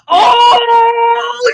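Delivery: high, loud startled cry; shocked shriek. Real, not acted. Friend reacting to a rude quote with a high shocked shriek. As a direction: shriek in mock outrage.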